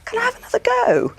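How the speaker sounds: high, small voice asking; a light mock-timid plea; timid request. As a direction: ask sheepishly in a high voice. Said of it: "rang them up and said".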